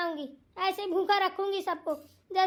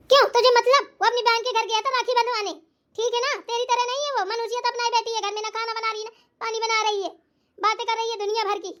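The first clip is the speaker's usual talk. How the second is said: higher-pitched rebuke with a loud start; angry scolding; 4 semitones higher, 4 dB louder, faster than usual. Acted. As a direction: jump in and tell off the daughter-in-law. Mother-in-law snaps back at wife. (बहू को डाँटती सास)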